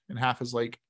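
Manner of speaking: ordinary talk, nothing marked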